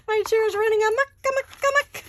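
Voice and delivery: high, loud, breathless with laughter; giggling helplessly, words squeezed out high; laughing uncontrollably. Real, not acted.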